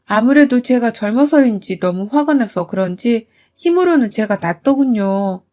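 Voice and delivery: ordinary delivery, nothing marked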